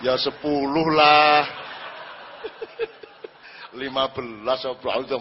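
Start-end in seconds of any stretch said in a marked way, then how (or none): 0.0-1.4 greedy demand, loud and slow. Retold or imitated. Greedy fisherman asks more. 1.5-3.7 laugh. Real, not acted.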